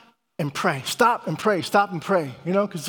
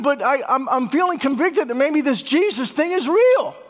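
urgent excited; 6 semitones higher than usual. Retold or imitated. Coworker's urgent confession retold.

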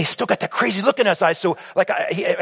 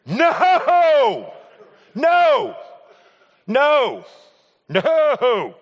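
shouted refusal; 10 semitones higher, much slower than usual. Real, not acted. Speaker reliving mom's request, shouting his refusal. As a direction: throw each no out as a comic wail.

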